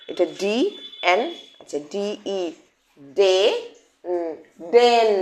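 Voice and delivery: slow sound by sound, ডি then ডে then ডেন; teacher blending sounds into ডেন. Shown as a demonstration. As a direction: build the word from its sounds, slowly. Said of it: "sounding out a word".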